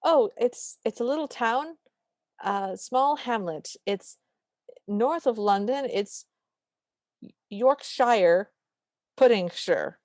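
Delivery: halting, groping for an answer; stalling with long gaps between phrases; floundering. Retold or imitated. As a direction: make it up haltingly as you go. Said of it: "And she flounders".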